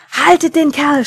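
loud, high yell for help catching someone; full-voiced alarmed command; urgent shout. A narrator's reading. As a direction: shout it across a swimming pool. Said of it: "schrie er".

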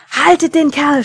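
loud high yell; shouting. Acted. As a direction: shout it across the pool. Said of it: "schrie er".

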